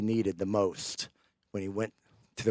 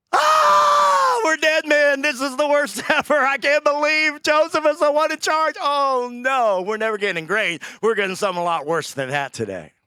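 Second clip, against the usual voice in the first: high, loud panicked shout, half laughing; panicked brothers; 9 dB louder than usual. Retold or imitated. Terrified brothers facing Joseph, panicked shouting. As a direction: howl it like doomed men caught out. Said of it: "And I'm sure they go".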